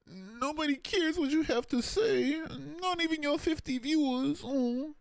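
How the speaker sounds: squeaky high mocking parrot voice; slow falsetto mimicry of the troll. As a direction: parrot his words back in a silly squeak. Mimicking a chatter's message.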